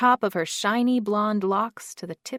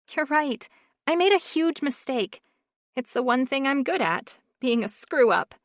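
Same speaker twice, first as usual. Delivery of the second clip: higher, lightly voiced tearful woman; a higher voice, blaming herself; Carly's voice; 7 semitones higher, 6 dB louder than usual. A narrator's reading. Carly admitting her mistake to Jarrett, higher and upset.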